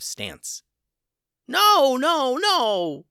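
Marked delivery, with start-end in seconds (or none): 1.5-3.1 high, loud panicked yell. A narrator's reading. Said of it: "yelled Vernon".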